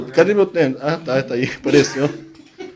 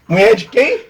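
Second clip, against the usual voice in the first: laughing; 5 semitones higher, 4 dB louder, much slower than usual. Real, not acted. Laughing at prank result.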